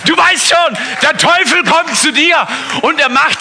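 high, loud proclaiming repeat; loud preaching echo. Acted. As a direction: throw the phrase out to the crowd. Prediger wiederholt laut. (laut predigend wiederholt)

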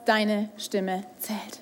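slow, intimate whisper. Acted. Preacher whispers the key line to the congregation. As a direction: whisper it like a precious secret.

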